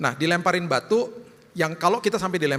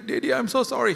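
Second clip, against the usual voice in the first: put-on child voice; 7 semitones higher than usual. Daughter apologizing in car.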